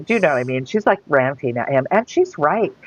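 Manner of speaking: ordinary talk, nothing marked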